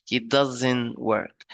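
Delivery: slow, stretched "doesn't"; "doesn't" given slow, deliberate weight; stress on "doesn't". Shown as a demonstration. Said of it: "it is stressed".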